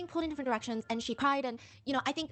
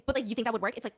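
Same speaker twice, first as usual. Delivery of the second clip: ordinary talk, nothing marked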